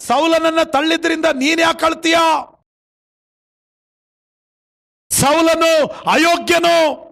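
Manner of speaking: sustained high sermon cry, endings stretched; forceful preaching. Acted. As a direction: exhort the congregation point by point. Preaches God's words forcefully. (ಏರುದನಿಯ ಪ್ರವಚನ)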